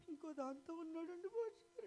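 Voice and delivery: high, put-on woman's wailing complaint; complaining wife. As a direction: whine it like a hurt wife. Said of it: "అన్నాడు".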